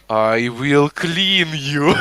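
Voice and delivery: very slow mock Russian accent. The cleaner's punchline to the man, slow Russian accent. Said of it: "speaking this Russian accent".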